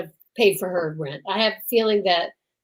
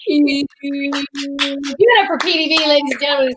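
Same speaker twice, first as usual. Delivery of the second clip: slow, drawn-out host call; mock emcee welcoming the birthday boy; emcee announcing; 4 semitones higher, slower than usual. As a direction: hype the crowd like a showman.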